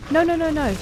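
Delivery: high, loud alarmed cry of no; real panic as the dog is hurt; panicked no. Real, not acted. Dog attacked in game.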